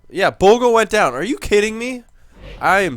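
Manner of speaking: loud gamer rage at a bad rating; frustrated outburst. Real, not acted. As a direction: blurt it out, genuinely annoyed. Favorite player's rating drops.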